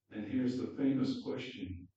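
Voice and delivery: normal talking voice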